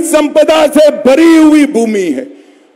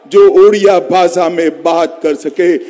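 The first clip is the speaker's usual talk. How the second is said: normal talking voice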